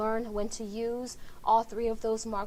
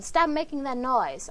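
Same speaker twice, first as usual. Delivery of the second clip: loud shouted order, higher; 4 semitones higher, 6 dB louder than usual. Shown as a demonstration. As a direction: bark the command to stop. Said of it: "I'm probably gonna shout that phrase".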